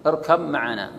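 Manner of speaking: اركب معنا as irkam-ma'ana, b becomes m; complete idgham. Shown as a demonstration. Teacher illustrates full merging, اركب معنا joined on m. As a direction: swallow the b of اركب into a doubled m. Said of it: "هذا إدغام تام".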